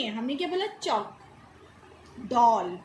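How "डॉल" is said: डॉल with the open vowel between aa and o, not plain o; teacher demonstrating डॉल with open vowel; open o sound. Shown as a demonstration. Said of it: "हम डॉल बोल रहे हैं".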